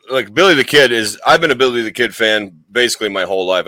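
usual speaking voice

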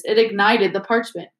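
ordinary delivery

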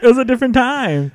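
high-pitched pleading excuse; mock defensive protest. Friend repeats the excuse while being teased, protesting defensively. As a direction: squeal the excuse in comic self-defence.

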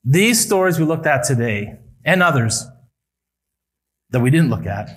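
normal talking voice

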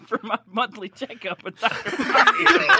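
giggling through the words; laughing. Real, not acted. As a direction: laugh while you say it. Joking laugh about Doctor Mario.

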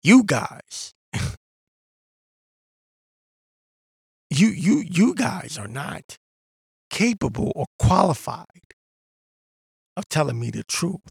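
lightly voiced sneering dismissal; mock condescending retort. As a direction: talk down to them as a smug know-it-all. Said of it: "They're thinking".

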